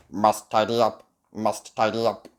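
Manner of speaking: robotic monotone at a steady pitch; loud robot repetition. A narrator's reading. Robot tidying the castle, steady mechanical chant. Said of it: "he repeats loudly".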